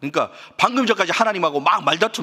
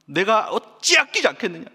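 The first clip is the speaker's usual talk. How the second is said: higher, aching rhetorical plea; pained compassion; 7 semitones higher than usual. Acted. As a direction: voice God's anguish with a pleading lift. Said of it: "하나님의 고통스러운 마음을 표현하는 거예요". (고통스러운 마음으로 애타게)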